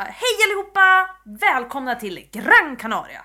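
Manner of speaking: loud, drawn-out tour guide welcome; hurtig reseledare. Acted. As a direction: greet the whole group like an over-eager tour guide. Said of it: "jag skulle bli reseledare". (överdrivet hurtig reseledare, högt och utdraget)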